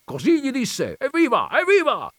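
very high, excited shouts; enthusiastic cheering. A narrator's reading. Coachman thrilled by the king's answer, enthusiastic shouting. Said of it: "gridava costui entusiasmandosi".

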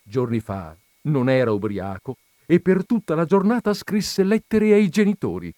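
plain reading voice

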